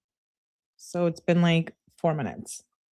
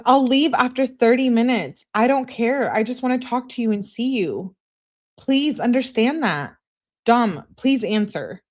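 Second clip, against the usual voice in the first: higher, insistent acted begging; pleading; 6 semitones higher, 4 dB louder than usual. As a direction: bargain desperately to be let over. Acts out begging texts.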